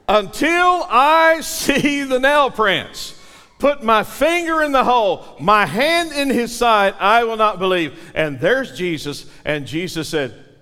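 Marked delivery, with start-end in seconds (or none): 0.1-8.0 higher and louder, insisting hard; defiant demand. Acted. Doubting Thomas insisting on proof before he believes, loud. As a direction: push it out with stubborn defiance.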